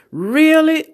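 retold gasp of surprise; mock astonished really. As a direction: act amazed at the season tickets. Retold store chat, shocked reply.

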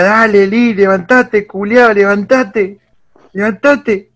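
replaying his own cheering at the screen; excited cheering. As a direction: cheer a fallen fighter to his feet. Cheering Lee on while watching.

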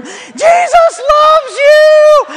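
very high, drawn-out loud shout; calling out. Retold or imitated. Suzette chasing Mark through the Quad, calling loudly. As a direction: sing it out after him across the lawn.

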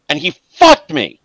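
rising resentment at being left to die; bitter betrayal. Acted. GM voices bitter betrayed NPC.

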